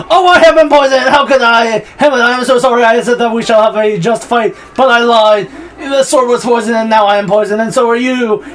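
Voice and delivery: high, loud, hammed-up anguish; melodramatic confession. Poisoned brother confessing to Hamlet, melodramatic wail. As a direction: wail it like an overacted death speech.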